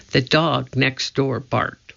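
ordinary delivery, nothing marked

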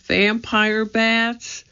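high, slow, stretched question; drawn-out, incredulous little voice; alarmed disbelief. A narrator's reading. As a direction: echo it in high-pitched disbelief. Annie echoes the alarming news.

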